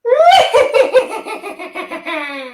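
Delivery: very high villain cackle; shrieking mad-scientist cackle; evil chuckle. Pretend. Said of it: "I got this".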